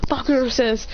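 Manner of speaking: plain reading voice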